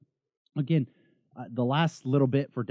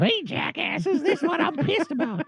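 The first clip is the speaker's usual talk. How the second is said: high, squeaky impression of a buddy; mock Jesse voice; 3 dB louder, slower than usual. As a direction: play the cranky friend, high and silly. Imitating Jesse on Skype.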